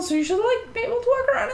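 put-on old man done as a bubbly Valley Girl; high, bubbly Valley Girl lilt. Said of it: "this old man has a Valley Girl voice".

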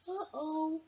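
put-on high little girl voice; a worried little girl; Emily's voice. Emily voiced in read-aloud story.

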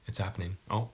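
normal talking voice

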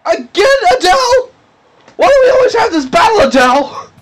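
very high, whining complaint; hammed-up exasperation. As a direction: wail it in mock exasperation.

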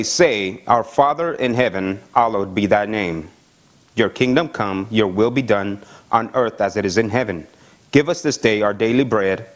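lightly solemn reading of scripture. Acted. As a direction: read the Lord's Prayer out to the congregation. Reads scripture passage aloud.

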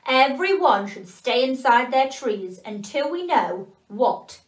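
put-on slow, loud warning of an old elf; grandfather elf addressing the elves. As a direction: call out the warning like a wise grandfather. Grandfather elf speaks to all elves.

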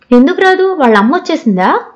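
eager question starting on a high pitch; eager, anxious. A narrator's reading. Avanti asks her friend about Charita's mother, eagerly. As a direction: jump in high, impatient to know. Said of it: "ఆత్రంగా అడిగింది".

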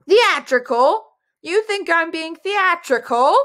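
high-pitched indignant outrage; hammed-up, affronted wizard; furious. A narrator's reading. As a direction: repeat the insult in offended fury. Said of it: "Antorel said furiously".

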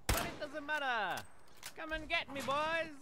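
high, excited battle taunt; taunting call. Real, not acted. Gamer to enemies, come and get me, high taunting shout. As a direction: call out high and cocky, daring them on.

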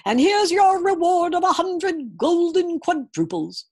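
high, loud royal proclamation; commanding king. A narrator's reading. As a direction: announce it like a grand king. King speaking in story.

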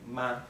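short, unstressed "ma"; a light, toneless little "ma". Shown as a demonstration. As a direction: say "ma" briefly, with no emphasis and no tone movement. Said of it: "it's pronounced shorter and, uh, is not emphasized".

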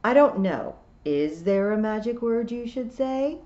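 testy retort. A narrator's reading. Toad turning Tiffany's question back, testy. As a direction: throw the question back at her.

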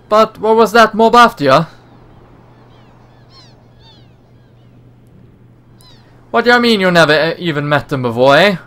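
put-on a slipping Australian accent. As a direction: play the brash Aussie rescuer. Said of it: "I can't really hold a accent".

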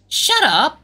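loud, high snap; angry outburst. Acted. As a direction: shut down the teasing with a sharp yell. Snapping back at teasing friend.

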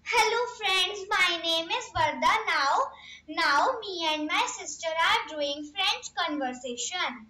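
usual speaking voice

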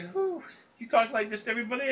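mock surprised customer. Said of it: "she's fine".

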